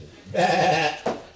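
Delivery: loud, high wavering baa; sheep bleat. Retold or imitated. Comic imitating sheep that turn up, sheep bleat.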